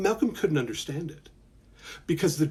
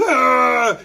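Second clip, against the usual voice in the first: mock scream; 9 semitones higher, 7 dB louder than usual. Shown as a demonstration. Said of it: "scream like Mom".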